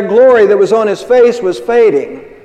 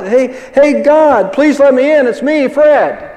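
mock holler; 7 semitones higher, slower than usual. Man calling to God at the pearly gates, mock hollering. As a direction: call out as if locked outside, lightly acted. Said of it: "finally he hollers out".

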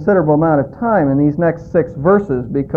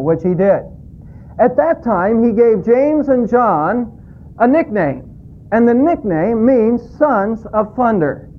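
higher, slower, building preacher's voice; fervent preaching; 5 semitones higher than usual. Real, not acted. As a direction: drive each phrase home slowly. Sermon rising into preaching.

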